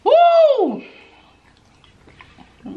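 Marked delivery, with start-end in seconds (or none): 0.0-0.8 high, loud, sustained woo; loud whoop. Real, not acted. Reacting to the meal on camera, loud whoop. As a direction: let out a big high whoop. Whoops alone while eating and drinking.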